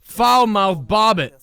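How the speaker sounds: announcer voice. Acted. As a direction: announce the champion slowly. Announcing the champion.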